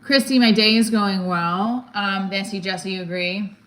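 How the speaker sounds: usual speaking voice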